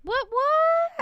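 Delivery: very high, loud startled double what. Real, not acted. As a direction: jump at the news. Startled by topic.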